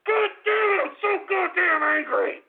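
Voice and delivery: high, drawn-out furious screaming; enraged high-pitched yelling; really angry. Real, not acted. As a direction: explode into a screaming tantrum. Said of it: "I'm really angry right now".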